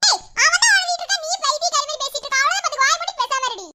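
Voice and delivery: high-pitched angry rebuke; angry scolding. Acted. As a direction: snap at her to shut up about the girl. Scolding a friend during teasing.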